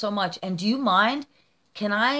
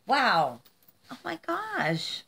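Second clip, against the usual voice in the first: awestruck; 6 semitones higher, slower than usual. Real, not acted. Vlogger gazing at a friend's painting, awestruck. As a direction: let "gosh" float up in wonder. Moved by the painting just received.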